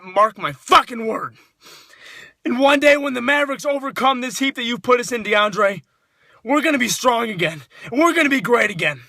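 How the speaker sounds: ordinary delivery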